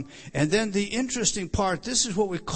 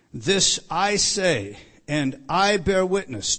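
slow, weighty quoted declaration; emphatic; 3 dB louder, slower than usual. Retold or imitated. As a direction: set each word down firmly, at half speed. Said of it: "to emphasize how emphatic".

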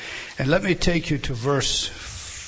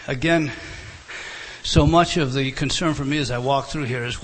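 ordinary delivery

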